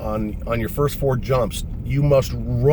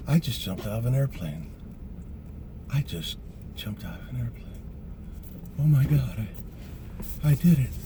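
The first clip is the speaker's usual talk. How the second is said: hushed, halting murmur to himself; soft, stunned disbelief with long pauses; awed inner voice; 8 dB quieter than usual. Retold or imitated. New jumper lying on the drop zone, hushed amazement. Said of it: "this is what's going on in my head".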